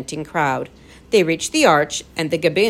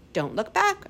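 urging; 13 semitones higher, 5 dB quieter than usual. A narrator's reading. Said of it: "urged the professor".

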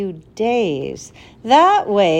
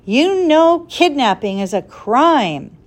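angry; 5 semitones higher, 5 dB louder than usual. Acted. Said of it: "Ben was furious".

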